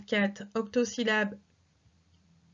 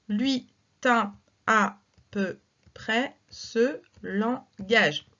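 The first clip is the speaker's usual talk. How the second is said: very slow, word by word scansion; slow verse reading; slower than usual. Shown as a demonstration. Teacher reads La Fontaine slowly for syllable counting. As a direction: draw out each syllable so pupils can count. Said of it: "E muet à la fin du vers".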